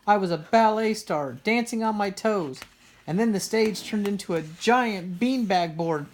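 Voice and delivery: high, loud upset wail. A narrator's reading. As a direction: wail through the whole dream. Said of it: "she wailed again".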